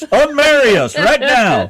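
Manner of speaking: high, loud, drawn-out mock demand. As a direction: holler it slowly at the judge, playing outraged. Acted demand to the judge.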